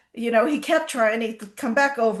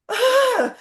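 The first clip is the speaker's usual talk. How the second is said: sudden high, loud "Ah!" of shock; startled horse cry; 14 semitones higher, 8 dB louder than usual. Retold or imitated. The foal thwarted by the mare's boundary, startled high yelp.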